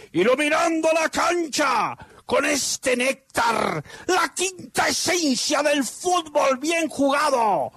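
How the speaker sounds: high, slow, soaring play-by-play; goal commentary. Acted. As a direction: call the goal like a radio announcer.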